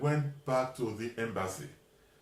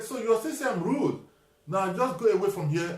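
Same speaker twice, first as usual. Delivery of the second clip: angry dismissal; 6 semitones higher, 5 dB louder than usual. Retold or imitated. Clerk angrily sending him away.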